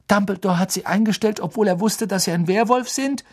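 high, fast incredulous question; snorting disbelief. Acted. Boy asks the teacher in high, fast disbelief. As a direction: blurt it out in disbelief. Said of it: "schnaubte Ron".

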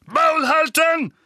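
high, drawn-out angry shout; loud command to shut up; thundering. A narrator's reading. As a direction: shout him down furiously. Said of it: "donnerten Onkel Vernon und Tante Petunia".

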